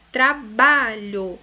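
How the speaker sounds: trabalho loud and slow, tra-BA-lho; stress on middle syllable. Shown as a demonstration. Teacher showing the strong syllable, trabalho stressed on ba. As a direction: punch the ba in trabalho. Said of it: "ba é a sílaba mais forte".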